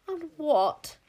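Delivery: high, loud, sharp queen; considerable asperity. A narrator's reading. Queen challenging the king witch, sharp. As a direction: hit it high and hard, with asperity. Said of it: "said the queen with considerable asperity".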